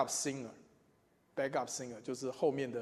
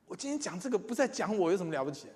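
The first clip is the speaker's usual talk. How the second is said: normal talking voice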